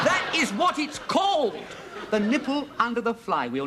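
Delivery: high, slow, loud insistence; exasperated shout. Acted. Husband answering the neighbour's prudish objection, exasperated shout. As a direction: spell it out at full exasperation.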